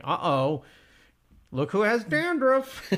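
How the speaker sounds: high, drawn-out singsong teasing; mock an old commercial's nosy friend. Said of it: "his friend is like".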